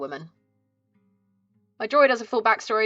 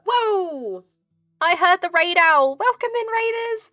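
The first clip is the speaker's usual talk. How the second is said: high, bright welcome to raiders; cute anime streamer; 9 semitones higher, 6 dB louder, slower than usual. Acted. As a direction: squeal hello to the incoming raid. Greets incoming raid.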